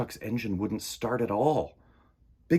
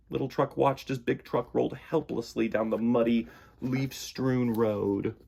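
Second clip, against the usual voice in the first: usual speaking voice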